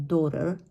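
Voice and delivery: daughter with a single short "aw"; correct short vowel. Shown as a demonstration. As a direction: say "daughter" as DAW-ter. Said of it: "just a very short sound".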